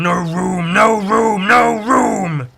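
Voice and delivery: loud, rising ghoulish wail; hammed-up chorus of the dead; corpses crying out. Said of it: "bodies that are already there are saying".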